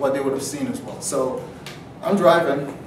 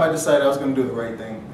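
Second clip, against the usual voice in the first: normal talking voice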